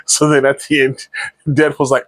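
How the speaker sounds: normal talking voice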